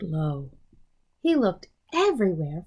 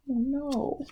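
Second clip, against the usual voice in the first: ordinary delivery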